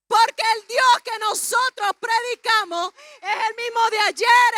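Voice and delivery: loud, high, emphatic sermon delivery; full-force preaching. Real, not acted. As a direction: proclaim it to the congregation, stretching key words.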